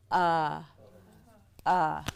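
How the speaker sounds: isolated "Uh", the schwa vowel alone; demonstrated schwa. Shown as a demonstration. Teacher showing the schwa's sound, demonstrated "Uh". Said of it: "schwa makes an uh sound".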